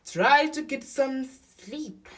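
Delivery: high, slow, loud tortoise voice; slow advice from a story tortoise; kindly Tortoise. A narrator's reading. Tortoise advises Elephant.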